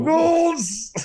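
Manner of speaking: high, loud drawn-out holler; big high whoop of a greeting; yelled catchphrase. Pretend. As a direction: call it out like a rallying cry.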